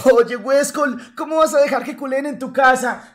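mock indignant fan; mocking a hater. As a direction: parody of an outraged viewer. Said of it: "gente que me escribe y me decía".

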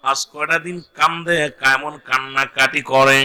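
normal talking voice

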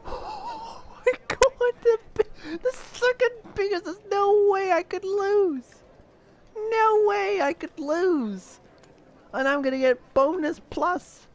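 high joyful shrieks with laughter; ecstatic screaming. Real, not acted. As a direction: scream it out in disbelief. Huge win, screaming with joy.

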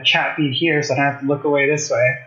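usual speaking voice

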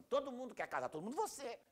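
high-pitched mock indignation; indignant retort, played up; indignant outburst. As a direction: protest it in acted outrage, pitched well up. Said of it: "A pessoa fica indignada".

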